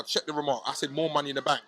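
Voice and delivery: normal talking voice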